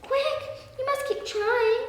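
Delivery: high-pitched little sparrow voice; urgent, high storybook pleading; urging. A narrator's reading. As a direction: urge him on in a high bird voice. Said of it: "urged some friendly sparrows".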